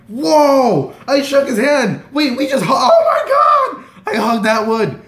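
high, shouted disbelief with laughing; freaking out. Retold or imitated. Fan who just hugged Atwood, freaking out. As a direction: shriek it like a starstruck fan. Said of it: "And I'm freaking out".

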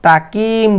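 "তাকিম" with an ordinary light k; light k mistake. Shown as a demonstration. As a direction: say "তাকিম" as the common mistake, plain k. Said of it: "অনেকে বলে".

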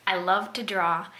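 ordinary talk

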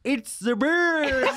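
high, stretched-out mock American accent; mock a terrible American audition, drawn out high; bad American accent. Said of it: "as an American".

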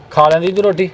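ordinary talk, nothing marked

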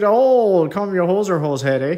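teasing a fellow Canadian in an exaggerated Canadian accent; mock Canadian accent. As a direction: ham up a Canadian hoser taunt, eh.

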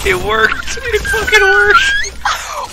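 high, stretched triumphant shout; excited cheer. Real, not acted. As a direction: cheer like the trick just paid off. Plan succeeded in game.

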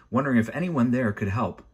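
usual speaking voice

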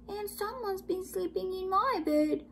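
high little cub voice; Baby Bear's cry. A narrator's reading. Baby Bear finds his bed used, high little cry. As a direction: pipe it up high and small. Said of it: "cried the Baby Bear".

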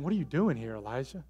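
softly hushed voice of God; low whisper. Acted. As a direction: ask quietly, dropping away on the name. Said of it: "the low whisper".

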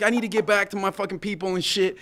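high, forceful holler; shouted appeal. Retold or imitated. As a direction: re-enact urgently calling to soldiers. Re-enacts shouted appeal to soldiers.

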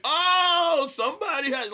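very high, loud drawn-out "oh"; mocking call-out. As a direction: cry out to expose someone in the crowd. Calling out audience member mid-bit.

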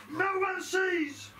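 very high, slow, stretched No one sees; drawn-out sing-song. Pretend. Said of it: "repeated, drawn out".

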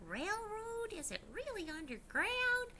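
put-on high, wondering child voice; lightly voiced puzzled child; little kids asking. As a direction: play a curious little kid. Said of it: "They were like".

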